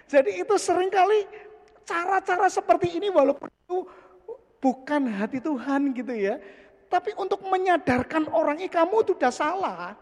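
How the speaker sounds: high, loud preaching push; fiery preaching. Acted. Preacher rousing the congregation, high and loud. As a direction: drive the point home to the congregation.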